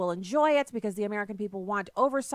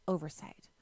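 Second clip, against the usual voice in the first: mock sweet whisper; 4 semitones lower, 6 dB quieter than usual. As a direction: whisper the word like a soothing secret. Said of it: "sweetly whispered over the airwaves".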